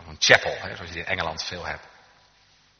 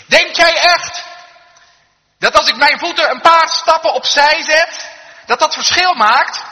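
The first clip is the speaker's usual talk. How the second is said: loud, high incredulous yell; shouting back; 16 dB louder than usual. Acted. Man on the tracks doubting the warning, shouting back. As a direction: holler a scoffing question across the distance. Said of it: "En die riep terug".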